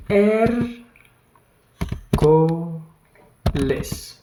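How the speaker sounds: er, co, les split into separate syllables. Shown as a demonstration. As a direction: spell out er, co, les one beat at a time. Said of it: "so we have mi".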